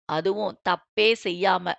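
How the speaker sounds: low, bitter resentment; grudging rage. A narrator's reading. Boss hints at an old wrong, low and bitter. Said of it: "கண்களில் குரோதத்தோடு சொன்னான்".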